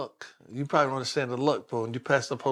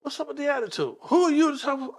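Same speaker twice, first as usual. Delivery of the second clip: heated retort; 11 semitones higher, 3 dB louder than usual. Retold or imitated. Arguing with the officer.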